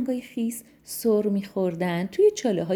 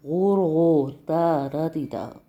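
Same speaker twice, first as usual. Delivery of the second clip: croaky frog voice; 4 semitones lower than usual. Acted. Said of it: "بادی توی گلوش انداخت و گفت".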